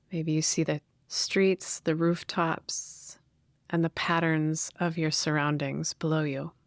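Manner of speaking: guided meditation. Real, not acted. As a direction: gently guide listeners inward, leaving space to breathe.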